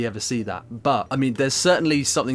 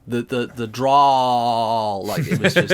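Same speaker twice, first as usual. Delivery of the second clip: a hint of a slow, louder Texas drawl; lightly imitating a Texas drawl, very slow; mock Texas drawl; 6 dB louder, much slower than usual. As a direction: drag the vowels like the Texan actor. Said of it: "He was, like, dragging his vowels".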